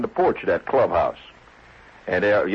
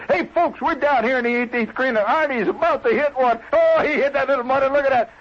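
high, loud, brash announcer voice, much higher and louder; a brassy TV announcer hollering the play; loud sportscaster; 12 semitones higher than usual. Shown as a demonstration. Mocking how golf is never called, a brash loud announcer. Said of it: "You don't hear Chris Schenkel ... say".

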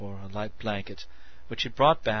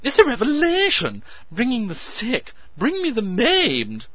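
excited; 13 semitones higher, 10 dB louder than usual. A narrator's reading. Meadows ecstatic proclamation.

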